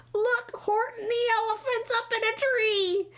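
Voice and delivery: very high, jeering cry; squealed mockery from a gleeful crowd; gleeful shouting. A narrator's reading. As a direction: call it out in teasing delight. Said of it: "they shouted with glee".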